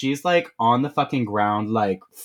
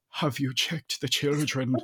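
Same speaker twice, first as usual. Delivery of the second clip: softer, weird creeper voice; creepy caller; 5 dB quieter than usual. Retold or imitated. Creeper phones the babysitting mother, hammed-up weird voice. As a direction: imitate a creep on the phone, hushed. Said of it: "Like, being weird again".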